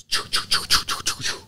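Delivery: whispered choo choo of a dying engine; imitated engine cutting out. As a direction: mimic the motor sputtering off in breathy puffs. Instructor cuts the engine, mimicking it.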